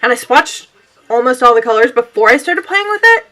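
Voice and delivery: usual speaking voice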